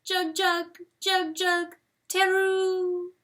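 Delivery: high, lonely calling bird cry; a bird's hopeless lament; wailing bird call. A narrator's reading. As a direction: let the call fade hopelessly. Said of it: "she cries from the rim".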